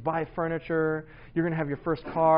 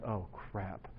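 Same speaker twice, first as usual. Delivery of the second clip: low, very soft deflated mutter; stunned dismay; 8 semitones lower, 13 dB quieter than usual. Retold or imitated. Dad hearing his kids' criticism, quietly dismayed. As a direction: let the voice sink right down. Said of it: "the blood drained from my face".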